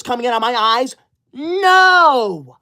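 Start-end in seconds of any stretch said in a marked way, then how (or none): 1.3-2.6 a high, loud, drawn-out No!; loud mock-offended no; indignant shout. Denying she will cry.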